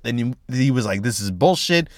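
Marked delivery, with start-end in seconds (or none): none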